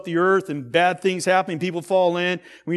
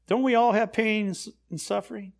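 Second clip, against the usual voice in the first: usual speaking voice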